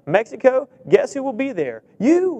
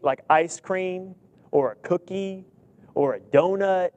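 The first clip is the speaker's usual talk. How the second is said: ordinary delivery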